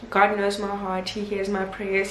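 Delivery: ordinary talk, nothing marked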